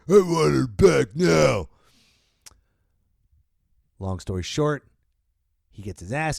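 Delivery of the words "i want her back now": louder, higher growled demand; mock furious demand; angry demand. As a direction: bark it out as a threat. Rambo demanding his daughter.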